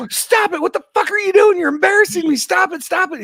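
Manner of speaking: high, loud, furious yelling; furious mom yelling. Retold or imitated. Wife yelling at her son to stop waving, furious shout. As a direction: scold him in a shrill-pitched panic of embarrassment. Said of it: "my wife is like".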